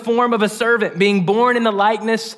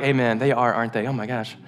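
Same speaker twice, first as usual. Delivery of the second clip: mock pious agreement; 10 semitones lower, 5 dB quieter, faster than usual. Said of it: "Some of y'all ... are like".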